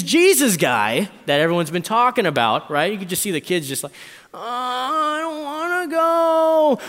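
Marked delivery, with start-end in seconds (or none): 4.3-6.8 put-on high, loud, drawn-out kid whine; whiny child's protest, stretched out; whining kid. Kid whining about the trip.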